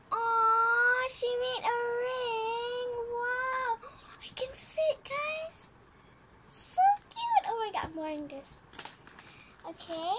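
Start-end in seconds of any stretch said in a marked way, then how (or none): 0.1-5.7 slow, higher, moved voice; touched delight. Real, not acted. Finding a handmade ring. 6.5-7.4 very high, loud squeal of delight; excited squeal. Real, not acted. Squealing over the ring.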